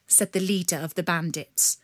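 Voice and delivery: ordinary delivery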